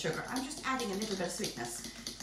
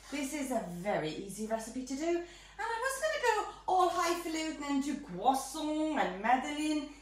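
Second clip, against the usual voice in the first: normal talking voice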